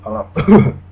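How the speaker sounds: ordinary talk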